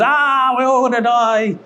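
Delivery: hammed-up doomsday cry; mock panic. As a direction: play up a silly panic. Mimics fear of machine uprising.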